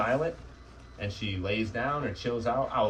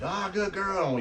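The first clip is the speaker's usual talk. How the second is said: much higher bright praise to the dog; happy praise; 11 semitones higher, 3 dB louder, much slower than usual. Real, not acted. Trainer praising the puppy for going in alone, high delighted praise. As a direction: sing it out to reward her.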